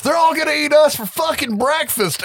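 high, loud imitation of a ranting conspiracy nut; freaked-out mock yell. Host imitating Rooker's crazy YouTuber, freaked-out yelling. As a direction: yell it in a panicky, hammed-up pitch. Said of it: "freaks out and randomly yells".

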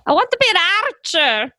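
high Scottish-heroine protest; hammed-up animated princess, pitched high; mock film heroine. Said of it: "that and her going".

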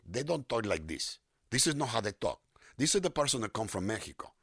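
thick, overdone Mexican accent. Shown as a demonstration. Voice actor showing how they don't talk, thick Mexican accent. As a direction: lay the Mexican accent on heavily. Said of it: "Mexican-American accents".

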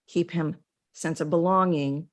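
ordinary delivery

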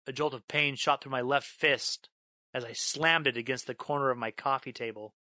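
ordinary delivery, nothing marked

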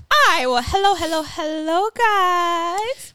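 loud, high show-opening hello; high, drawn-out "hello, guys"; excited greeting. Real, not acted. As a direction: greet the listeners with big energy. Show's opening greeting after banter.

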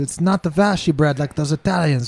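dismissive mock Portuguese baker; fake Portuguese accent. Scoffing at Italian bread in a fake Portuguese accent. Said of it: "racially ambiguous fucking accent".

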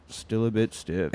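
slow and quiet, deadpan. Retold or imitated. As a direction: drag it out flatly. Deadpan Nicholas, slowed.

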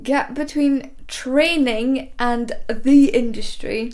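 deliberate slow take; slow, careful retake. Shown as a demonstration. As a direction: say each word slowly and clearly. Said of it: "Take two".

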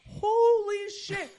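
high, loud, long-drawn amazement; stunned exclamation. Retold or imitated. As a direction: stretch it out in loud disbelief. His stunned reaction to the sprinter.

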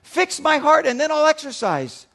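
high, loud whine to the doctor; whiny plea. Retold or imitated. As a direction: complain loudly like a lazy patient. Patient whining at doctor.

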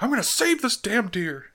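high-pitched cartoon-character vow; determined deer surgeon. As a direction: declare it as the frantic rescuer. Quotes character operating on deer.

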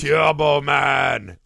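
put-on slow, loud Austrian-accent shout of "Turbo Man"; Arnold accent. Pretty memorable accent.

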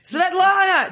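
high, loud calling out; yelling across the house. Retold or imitated. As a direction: yell a name from upstairs. Parents yelling across floors.

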